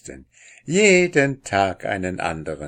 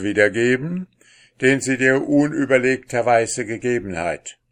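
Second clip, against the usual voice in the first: a somewhat louder stern king; 5 semitones higher, 7 dB louder than usual. Acted. The king orders Pechvogel to kiss his daughter, sternly. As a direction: command the prisoner before the court. King commands before the court.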